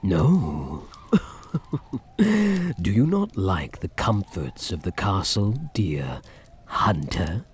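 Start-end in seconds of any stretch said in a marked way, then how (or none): 1.5-2.1 soft breathy chuckle. Acted. Vampire teasing captive.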